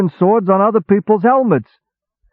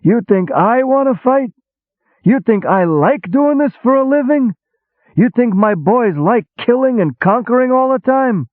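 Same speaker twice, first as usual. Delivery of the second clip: sarcastic eruption; 13 semitones higher than usual. A narrator's reading. Julie scoffing at the hero, a high sarcastic eruption. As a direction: throw the words back at him scornfully. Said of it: "Julie erupted sarcastically".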